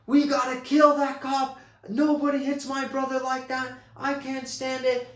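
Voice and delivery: high, loud furious outburst; furious brother. Retold or imitated. Brother raging about the cop, furious high yell. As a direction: rage like the hothead brother. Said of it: "his one brother is furious".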